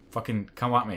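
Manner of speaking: ordinary delivery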